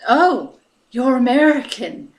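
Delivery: loud, high surprised exclamation; surprised local. Retold or imitated. Locals surprised at her accent.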